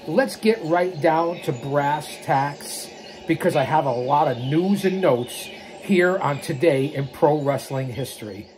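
ordinary delivery

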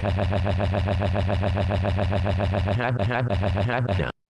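long, droning stuttered noise; monotonous machine-gun "ha-ha-ha"; imitated noise. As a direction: rattle off one syllable mechanically, on and on. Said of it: "fucked our piano like".

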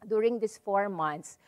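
ordinary talk

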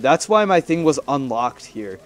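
ordinary delivery

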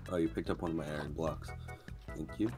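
normal talking voice

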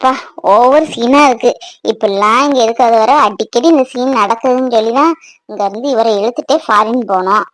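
low, fed-up inner monologue; grumbling aside. Acted. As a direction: complain under your breath at the tearful scene. Wife grumbling aside at family scene.

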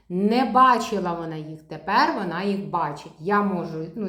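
usual speaking voice

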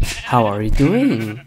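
usual speaking voice

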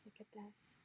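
very soft, unvoiced wonder; hushed whisper. Real, not acted. Showing the viewer a gift piece, hushed whisper. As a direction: whisper like you don't want to break the spell.

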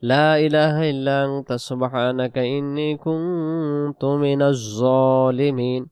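slow, drawn-out tajweed recitation; Quran recitation. Shown as a demonstration. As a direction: recite slowly, holding the long vowels.

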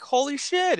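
high-pitched astonished recognition; mock amazed, high voice; acted surprise. Acting out recognizing an old schoolmate.

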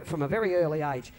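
ordinary talk

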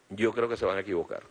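usual speaking voice

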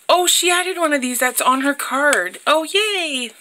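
high, delighted squeal of discovery; light up at the find; excited. Real, not acted. Opening happy mail, thrilled to spot the stamp, excited. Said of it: "I'm excited".

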